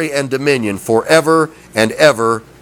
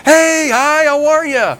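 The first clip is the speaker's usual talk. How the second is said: much higher, thrilled to hear from them; delighted high greeting; 12 semitones higher than usual. Retold or imitated. Answering a loved one's phone call, delighted high greeting. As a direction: squeal hello to a loved one who finally called. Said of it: "You're like".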